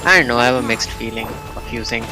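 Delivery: usual speaking voice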